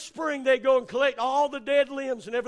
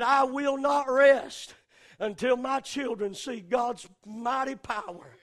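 quiet appeal; 5 semitones lower, 4 dB quieter than usual. Acted. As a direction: pull it down to a quiet vow.

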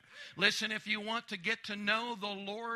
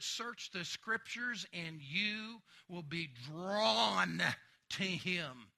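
ordinary talk

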